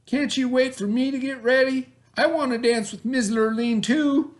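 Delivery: high, hopeful asking; pleading Bubba. A narrator's reading. Bubba asking his family to wait for him, high. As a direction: beg to come along. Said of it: "Bubba asked".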